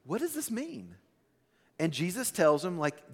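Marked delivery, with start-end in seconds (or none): 0.0-1.0 high, soft, puzzled asking; puzzled question. Acted. Disciples ask Jesus about the parable, puzzled. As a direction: lightly act out a confused follower. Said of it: "they ask Jesus".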